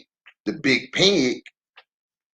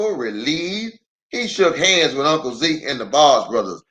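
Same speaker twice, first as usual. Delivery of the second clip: ordinary delivery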